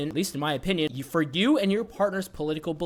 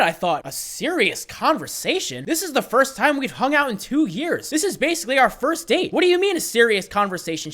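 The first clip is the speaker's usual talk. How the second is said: surprised; 7 semitones higher, 3 dB louder than usual. Retold or imitated. Shocked inner voice at her request.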